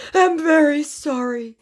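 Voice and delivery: mock pleading apology. Scammer grovels to narrator for forgiveness, high whiny voice.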